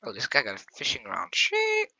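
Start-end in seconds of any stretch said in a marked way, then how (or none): 1.3-1.9 high, sudden startled yelp; startled curse. Real, not acted. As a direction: blurt it out in shock. Sudden danger in game.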